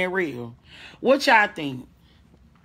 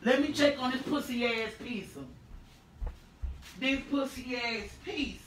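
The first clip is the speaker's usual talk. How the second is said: much softer, far from the mic; 14 dB quieter than usual. Real, not acted. Streamer checking pizza at the oven, off mic. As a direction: speak from across the room.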